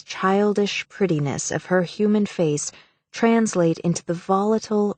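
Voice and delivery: plain reading voice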